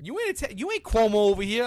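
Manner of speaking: high and loud mocking jab; loud, high mock indignation at the lady; mock outrage. Real, not acted. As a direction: call her out, half joking.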